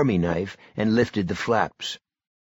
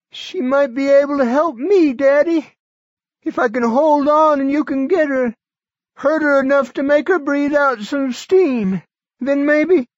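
put-on high, loud, strained old man; a feverish old man pushing through; sick old man; 7 dB louder than usual. A narrator's reading. Barry voiced.

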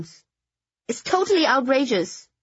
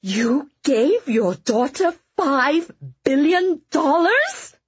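shocked disbelief; 4 semitones higher, 4 dB louder, much slower than usual. Acted. Kitty shocked at the sum.

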